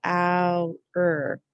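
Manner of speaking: Ow and er said separately; syllables sounded apart. Shown as a demonstration. Teacher repeats the sounds of flower. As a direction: split it into Ow, er. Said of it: "Say the sounds again".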